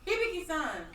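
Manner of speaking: yelling a name. Acted. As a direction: yell it out high, dropping at the end.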